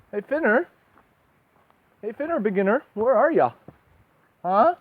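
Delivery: high, loud call across the room. Real, not acted. Searching for Finner, loud high calling out. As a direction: call out to find him.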